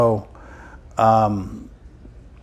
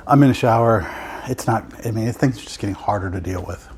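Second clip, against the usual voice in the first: ordinary delivery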